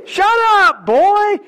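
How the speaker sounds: hammed-up angry put-down; acted scolding. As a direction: shout him down like a hostile crowd. Acted crowd silencing the beggar.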